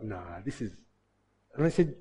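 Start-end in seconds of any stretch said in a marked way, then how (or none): 0.0-0.7 low, quiet muttered aside; muttered doubt. Retold or imitated. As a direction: say it under your breath, doubting him. Reporters doubting the old runner.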